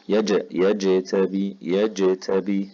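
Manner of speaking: يجتبي very slow, the ج bounced clearly; slow qalqala. Shown as a demonstration. Teacher drilling qalqala slowly on one word. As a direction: stretch يجتبي and echo its ج. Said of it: "لازم أوضح هذه الذبذبة والقلقلة".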